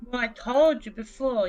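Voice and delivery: ordinary delivery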